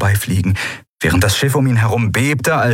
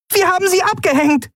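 much higher, cheering; small creature's high delighted cry; jubilant. Acted. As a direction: cheer that they got away. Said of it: "jubelte Nelen".

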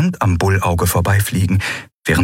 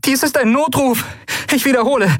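urgent mayday. Acted. Distress call on radio.

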